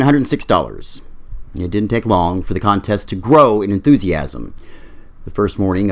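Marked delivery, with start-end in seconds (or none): none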